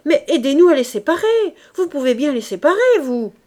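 loud, high-pitched plea for help; urgent appeal. A narrator's reading. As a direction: call out over the fight, pitched high. Urgent appeal during a fight. (appel pressant et fort)

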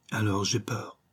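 soft, subdued admission of fear; understated dread; fearful. A narrator's reading. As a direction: confess it weakly, voice dropped. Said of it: "Une crainte terrible venait de l'empoigner".

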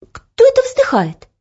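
high, hesitant asking; timid question. Acted. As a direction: ask who's there, a little scared. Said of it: "негромко спросила".

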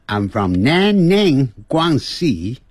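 "南宁，广西" in slow, foreign-sounding tones; foreign accent on Chinese names. Shown as a demonstration. Teacher shows the needless foreign accent on "南宁，广西". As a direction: mimic a foreigner saying "南宁，广西", drawn out. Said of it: "如果要学这个外国腔".

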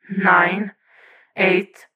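tense count over the comm; mildly tense, spaced-out numbers; tense countdown. A narrator's reading. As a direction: count down as a warning. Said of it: "Oracle cautions in Tim's ear, tense".

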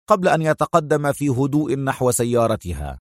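ordinary delivery

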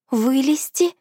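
high, horrified disbelief; horrified question. A narrator's reading. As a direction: gasp it out in horror. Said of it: "Голос подруги был полон ужаса".

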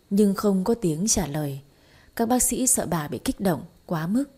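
usual speaking voice

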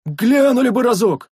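high, loud drawn-out plea; pleading yell. A narrator's reading. Sentry pleading with his master, yelling high. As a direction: wail for one look. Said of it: "завопил дозорный".

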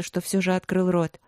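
ordinary delivery, nothing marked

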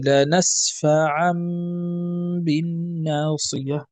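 slow "لنسفعن بالناصية", tanween as a hummed m; iqlab with ghunna. Shown as a demonstration. Teacher demonstrates tanween turned to m, long ghunna. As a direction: stretch the hidden m ending "لنسفعن" before b. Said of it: "تقلب ذاك التنوين ميما".

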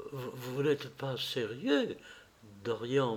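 soft, slow, halting voice; choked murmur. Acted. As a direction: murmur as if the words catch in the throat. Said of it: "d'une voix qui s'arrêta dans la gorge". (murmure étranglé, hésitant)

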